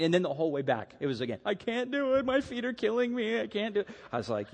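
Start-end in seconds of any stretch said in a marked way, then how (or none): 1.4-3.9 put-on high-pitched little-boy complaint; mock whining child. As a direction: imitate a worn-out kid whining to dad. Imitates son whining on the hike back.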